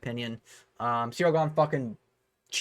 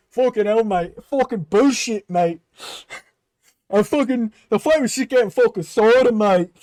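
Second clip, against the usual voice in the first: furious shouted British rant, much louder; Tom Aspinall rant; 6 semitones higher, 7 dB louder than usual. Retold or imitated. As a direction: rage at the cheating like an angry British fighter. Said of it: "That was Tom Aspinall".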